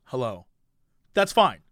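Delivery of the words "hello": flat hello. Shown as a demonstration. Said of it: "if you said".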